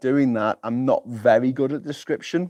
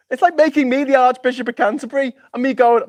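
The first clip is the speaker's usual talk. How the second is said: high, squeaky and louder; incredulous rant; 12 semitones higher, 5 dB louder than usual. Real, not acted. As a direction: keep the pitch up in disbelief.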